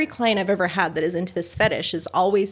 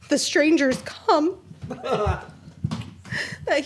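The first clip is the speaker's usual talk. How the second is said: laughing; 6 semitones higher, much slower than usual. Real, not acted. Laughing mid-story.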